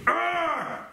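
high, strained growl of frustration; thwarted devil's exasperated "arrgh"; frustrated growl. Retold or imitated.

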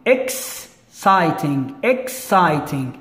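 "Exciting" very slow, broken after "Ex"; syllable by syllable. Shown as a demonstration. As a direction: stretch "Exciting" into separate syllables for learners. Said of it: "This is two syllables".

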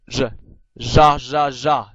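za said "zha" like the sound in pleasure; tongue curled back, buzzing za, slow and loud; hard ZH. Shown as a demonstration. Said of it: "類似英文pleasure嗰個z". (捲脷讀za，好似pleasure個音)

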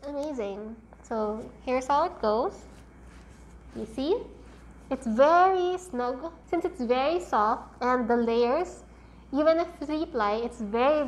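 muffled by cloth mask; through a face mask. Real, not acted. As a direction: mouth covered by fabric. Seen 1: Pink cloth mask covers her mouth and nose throughout the labelled words.

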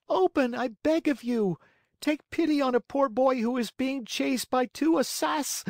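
high, loud, desperate cry; cried out. Acted. Pinocchio cries up to the maiden's window, loud desperate plea. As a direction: call up to the window in despair. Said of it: "cried Pinocchio".

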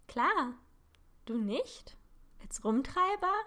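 put-on cheeky girl daring her friend, loud and teasing. A narrator's reading. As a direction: egg him on with a light dare. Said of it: "fragte sie neckend".

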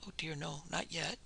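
hushed, breathless denial. Acted. Frightened wife hushes her reply to the doctor. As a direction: drop to a shocked hush. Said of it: "she gasped".

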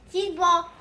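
cheeks stuffed full. Real, not acted. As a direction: mouth crammed with cheese balls. Mouth stuffed with cheese balls.

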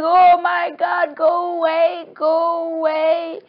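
loud, high, drawn-out pleading; shrieky high mock panic; acted panic. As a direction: wail at a ghost in mock terror. Acted panic before the ghost.

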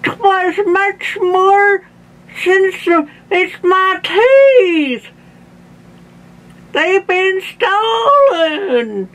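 very high, slow wail; hammed-up tearful old man, words slurred without teeth; distraught toothless Grandpa. A narrator's reading. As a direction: draw out the misery at a high pitch. Toothless Grandpa wails over stolen teeth.

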